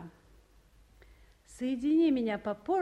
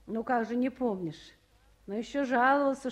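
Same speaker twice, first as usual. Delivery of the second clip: usual speaking voice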